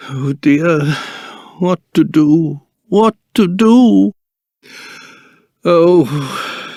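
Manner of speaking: low, drawn-out worried lament; fretting. Acted. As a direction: sigh it to yourself, at a loss. Fretting alone over a problem.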